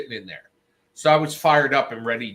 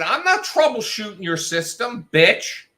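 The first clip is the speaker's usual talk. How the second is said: high, loud scolding, slowing near the end; retold angry rant; 9 semitones higher, 5 dB louder than usual. Telling the manager to fix it himself, angry. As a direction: lay into him, furious. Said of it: "I said".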